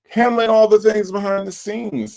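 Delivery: high, loud hype praise; hyped shout-out. Real, not acted. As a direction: pump up the mod's work. Hyping up a chat mod.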